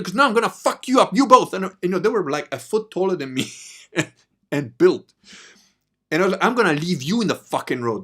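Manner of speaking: high, loud mock rage; furious threat. Narrator threatening the two big guys, furious shouting. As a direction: threaten them like you'll fight both.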